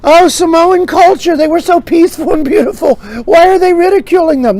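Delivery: high, loud hammed-up bleeding heart; mock outrage. Objector defending Samoan culture, mock outrage. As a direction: lament it like a scandalised do-gooder.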